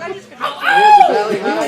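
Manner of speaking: very high, loud, slow disbelief; shrieked disbelief; incredulous. Real, not acted. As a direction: squeal it up in shock. Disbelieving reaction to audience.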